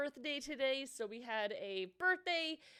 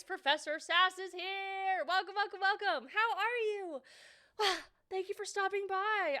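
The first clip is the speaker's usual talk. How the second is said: ordinary talk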